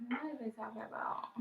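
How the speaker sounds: real whisper while reading. Real, not acted. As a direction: whisper it under your breath. Reading comments to herself.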